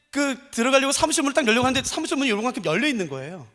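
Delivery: ordinary talk, nothing marked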